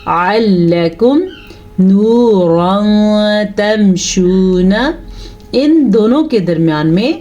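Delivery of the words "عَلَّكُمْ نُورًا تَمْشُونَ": slow, measured tajweed recitation; careful teaching recitation; Quranic recitation. Shown as a demonstration. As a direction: recite it slowly, drawing out the vowels. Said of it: "آئین کی تلفظ سیکھ لیں".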